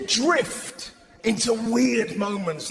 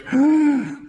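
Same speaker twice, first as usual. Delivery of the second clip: sharp gasp; 3 semitones higher, 5 dB louder than usual. Retold or imitated. Brain startles.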